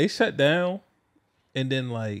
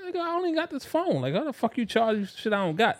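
high mock outrage; 7 semitones higher, 5 dB quieter than usual. Retold complaint to phone company.

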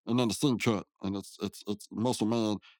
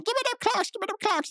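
much higher, demanding cartoon voice; squeaky demand. Rigby demanding the clocks from Mordecai, high and bratty. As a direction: screech for the clocks like a bratty sidekick.

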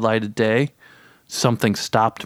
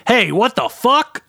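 high, drawn-out indignant holler; calling out; 11 semitones higher, 4 dB louder than usual. A narrator's reading. A man in the hole calls to passer-by, calling out. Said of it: "a man called out".

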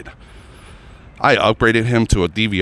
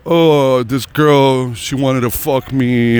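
drawn-out, higher mocking brag; mock bragging cable guy; 4 semitones higher, much slower than usual. Cable guys boast of their conquests, mocking drawn-out brag. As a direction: stretch it out like a boastful guy's tale.

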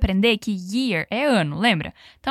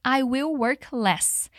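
slow model sentence; 2 semitones higher, much slower than usual. Shown as a demonstration. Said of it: "repete comigo".

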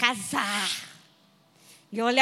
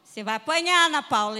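mock yelling mother; 3 semitones higher, 4 dB louder than usual. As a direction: yell at your daughter to do chores. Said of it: "E minha mãe gritando".

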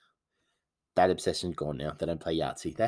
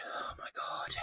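exasperated whisper; 14 dB quieter, slower than usual. Retold or imitated. Exasperated aside about the obsession.

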